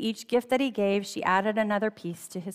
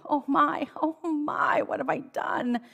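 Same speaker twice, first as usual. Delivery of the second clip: higher, hammed-up storybook moan; 7 semitones higher than usual. A narrator's reading. Sleepless king moaning about the woman on the island. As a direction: let the king moan it out, voice pitched up. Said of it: "he moaned".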